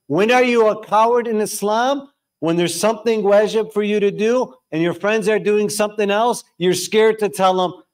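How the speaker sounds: high-pitched, urgent sermon voice; impassioned preaching. Real, not acted. Preacher challenging youth about cowardice, impassioned preaching.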